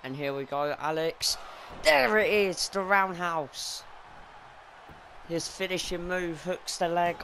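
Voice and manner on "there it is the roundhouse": excited call. Real, not acted. Calling the finishing move.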